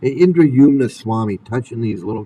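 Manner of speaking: ordinary talk, nothing marked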